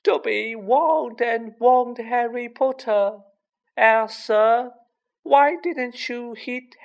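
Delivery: high, slow creature voice, reproachful; distressed house elf. A narrator's reading. House elf reproaching Harry for missing his warning, distressed wail. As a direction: lament it high and drawn out.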